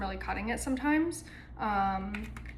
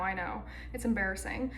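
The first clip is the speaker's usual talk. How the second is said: ordinary talk, nothing marked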